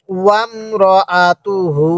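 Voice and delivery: ওয়ামরাআতুহু syllable by syllable, last hu held; measured chant of ওয়ামরাআতুহু with drawn-out ending; slow model recitation. Shown as a demonstration. Teacher asking learners to say along, slow measured ওয়ামরাআতুহু. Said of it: "আরেকবার বলুন".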